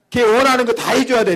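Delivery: emphatic answer. Real, not acted. Preacher insisting to the congregation. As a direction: drive the point home.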